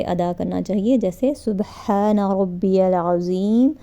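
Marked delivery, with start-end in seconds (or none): 1.4-3.7 unhurried tasbih, each word drawn out; slow, calm recitation. Shown as a demonstration. Teacher demonstrating how to say the ruku tasbih: very slow and calm. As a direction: let the praise unfold slowly. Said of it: "اس طرح آرام سے".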